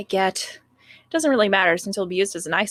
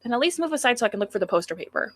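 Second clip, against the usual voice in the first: normal talking voice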